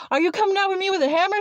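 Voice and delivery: high, rapid retold question of disbelief; mock alarm. Teller replaying the fear of a hammer coming, mock alarm.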